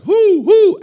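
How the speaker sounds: high, loud mock owl hoot. Preacher imitates owls with high, loud hoots.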